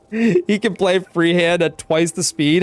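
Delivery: normal talking voice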